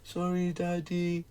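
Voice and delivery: softly voiced sorry from a little daughter; a hint of a mopey child; lightly voiced apologetic daughter; sad little girl. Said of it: "she's so depressed".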